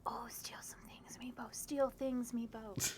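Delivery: conspiratorial whisper; whispered egging-on. Pretend. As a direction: whisper it like a sneaky temptation. Aside egging on Meepo's player.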